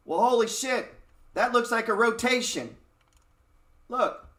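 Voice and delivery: a real rush of excitement at the chart; excited. Real, not acted. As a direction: blurt it out like you just struck gold. Spots a sector rotation on chart.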